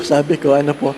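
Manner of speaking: usual speaking voice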